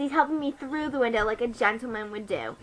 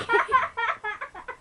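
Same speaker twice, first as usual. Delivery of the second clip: high laugh; 9 semitones higher, 3 dB quieter than usual. Real, not acted. Laughs mid-quote.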